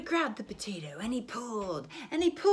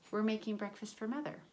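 plain reading voice

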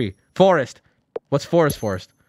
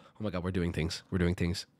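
quiet delighted aside; 9 semitones lower, 9 dB quieter than usual. Real, not acted.

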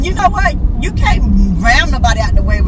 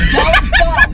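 high, whining warning; 1 high protest. Real, not acted. Sister protesting before teasing starts, high whine. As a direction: cut her off, pitched up.

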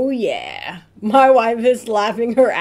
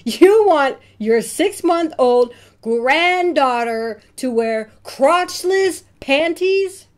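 slow, high, louder horrified question; horrified disbelief; 5 semitones higher, 5 dB louder, slower than usual. Acted. Parent to grandma over baby gift, slow horrified disbelief. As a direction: drag it out in shocked disgust.

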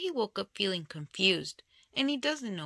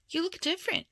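surprised; 10 semitones higher, faster than usual. Acted. Surprised at the monster.

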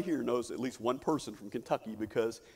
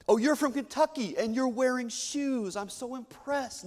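mock impressed; 8 semitones higher than usual. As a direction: put on fake amazement, then drag out the praise. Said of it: "they'll say".

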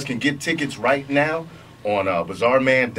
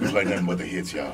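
radio voice; 4 semitones lower, 5 dB quieter, faster than usual. Pretend. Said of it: "let me get my radio voice on".

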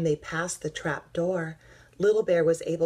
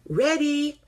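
high, loud call; shout; 12 semitones higher, 7 dB louder than usual. A narrator's reading. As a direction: call it out like a starter. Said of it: "shouted Duck".

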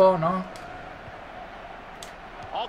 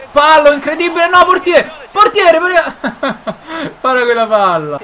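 outraged yell; 8 semitones higher, 7 dB louder than usual. Real, not acted. Yelling at the game's goalkeeper.

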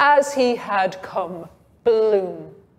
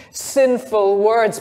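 plain reading voice

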